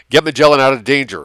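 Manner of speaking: quick, loud command; ordered. A narrator's reading. Kostler orders the vehicle out of danger, urgent command. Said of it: "ordered Kostler".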